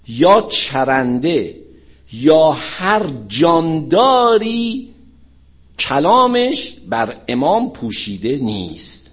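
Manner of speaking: very slow, emphatic preacher's quotation; weighty, loud-edged recital with long pauses; solemn recitation. Retold or imitated. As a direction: declaim it phrase by phrase, stretching the words. Recites the Imam's saying.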